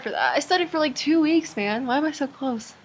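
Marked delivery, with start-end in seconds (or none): 0.4-1.6 raised, emphatic brag; proud and emphatic; excited boast. Real, not acted. Repeats boast, then whispers it.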